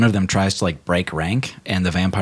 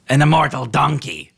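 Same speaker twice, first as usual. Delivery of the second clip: raised, higher-pitched call to a crowd; higher, projected scoffing call; mocking announcement; 7 semitones higher, 4 dB louder than usual. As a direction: announce it to everyone in disbelief.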